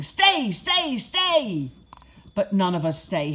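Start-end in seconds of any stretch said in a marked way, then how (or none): 0.0-1.7 higher-pitched urgent command, only moderately loud; shouting. A narrator's reading. Owners to puppies chasing treats, shouting. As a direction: call out to runaway puppies. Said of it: "All the two legs are shouting".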